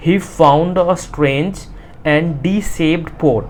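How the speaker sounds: very slow, word-by-word reading; slow reading aloud. Shown as a demonstration. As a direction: dictate it to the class. Teacher reads textbook line. (धीरे-धीरे पाठ पढ़ना)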